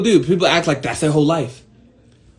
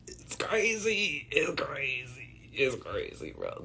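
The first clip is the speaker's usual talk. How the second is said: slow hushed whisper; 2 semitones lower, 17 dB quieter, much slower than usual. Real, not acted. Telling viewers about people's fear, slow whisper. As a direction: breathe it out in disbelief.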